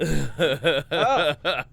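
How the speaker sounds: loud laughing sigh. Real, not acted. Wrapping up his story, laughing out loud.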